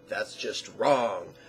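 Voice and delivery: low, slow naysayer; mock disapproval. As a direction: play the objector, dragging out the verdict. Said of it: "some people will say".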